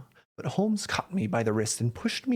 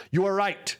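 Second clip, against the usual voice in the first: raised, higher-pitched outburst; crying out; 7 semitones higher than usual. A narrator's reading. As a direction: burst out in mild agitation. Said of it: "he cried".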